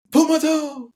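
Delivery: mock plea. Imitating his own plea, a running joke.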